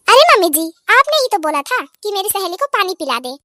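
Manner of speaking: ordinary delivery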